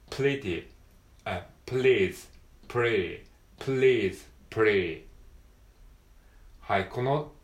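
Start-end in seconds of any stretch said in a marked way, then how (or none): 0.1-5.0 pretty with R and please with L, alternated; pretty please alternation. Shown as a demonstration. Teacher testing L versus R for learners. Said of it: "これ違いますよね".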